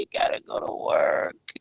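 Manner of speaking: breathy whisper of resignation; mock whispered complaint; whispered grumble. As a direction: whisper it like a defeated sigh. Grumbling about work.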